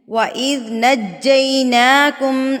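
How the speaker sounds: slow chanted tajweed recitation; Quran recitation. Acted. Teacher recites the verse in slow tajweed chant. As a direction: recite it slow and melodic, drawing out vowels.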